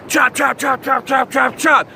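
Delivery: chanted chop chop like rotor blades; helicopter chop noise. Retold or imitated. Surfer imitating helicopters overhead, rhythmic chopping.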